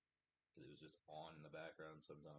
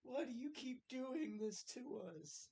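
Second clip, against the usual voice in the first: very high, loud, drawn-out mock yell; hammed-up despair, pleading with the heavens; Joey's anguished wail; 8 dB louder, slower than usual. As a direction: imitate a sitcom character wailing at God. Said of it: "he just looks back up at the camera".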